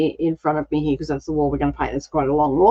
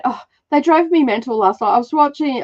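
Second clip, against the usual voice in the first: normal talking voice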